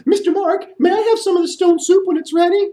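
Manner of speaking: very high bat voice; squeaky bat. Acted. Bat flying down calling to the storyteller in a high squeak. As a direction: chirp it like a small bat.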